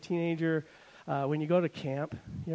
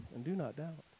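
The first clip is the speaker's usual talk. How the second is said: normal talking voice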